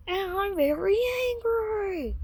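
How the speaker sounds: high, slow put-on voice for a sulky rebel; hammed-up grumpy region; mock angry rebels. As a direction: pitch up and drag it out like a pouting province. Southern part voiced as angry.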